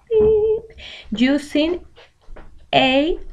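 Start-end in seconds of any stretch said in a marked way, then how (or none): 0.1-0.6 high-pitched beep sound; imitated beep. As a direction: squeak it out like a tone.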